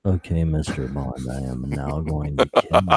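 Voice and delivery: mocking a dull Bond villain in a deep, hushed monotone; Michael Lonsdale. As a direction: drone it low and quiet enough to put Bond to sleep. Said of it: "Michael Lonsdale was so dull".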